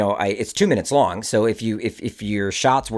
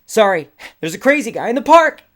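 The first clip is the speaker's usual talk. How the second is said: high, loud call to a passerby; yelled warning; 13 semitones higher, 8 dB louder than usual. Retold or imitated. Runner warning woman reading in the grass, yelled warning. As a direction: yell it out in a panic as you run. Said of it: "So I yell out to her".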